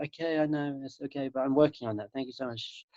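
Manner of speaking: soft, patient brush-off; gentle reassurance, fading very quiet; soothing self-talk. Acted. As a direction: calmly hush the inner critic. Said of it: "treat your mind like a baby".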